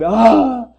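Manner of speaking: sudden loud wailing cry; the dakini bursts into a loud, high cry of grief; anguished cry. Acted. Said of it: "La dakini se pone a llorar". (grito agudo de dolor de la dakini)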